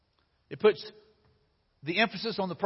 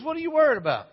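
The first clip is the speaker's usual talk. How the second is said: mock driver; 9 semitones higher, 3 dB louder, faster than usual. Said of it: "he goes".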